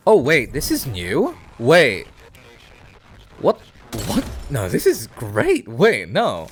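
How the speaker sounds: loud, sudden surprised excitement; real excitement at a new find; excited. Real, not acted. Excited at new game item.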